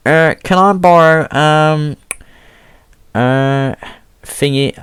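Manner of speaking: high, hesitant imitation of the head of year; mock high teacher voice. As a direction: squeak it out like an awkward teacher. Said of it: "he's like".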